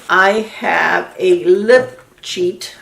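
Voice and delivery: "lip cheat" drawn out slow and deliberate; slow careful "I have a lip cheat"; said slowly. Shown as a demonstration. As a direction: spell it out slowly so it comes out right. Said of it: "I have to say that slow".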